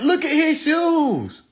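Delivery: put-on high, slow taunting kid; mock roasting kid. Kid in the lunch line roasting him, high taunting. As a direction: sing out the taunt like a schoolkid.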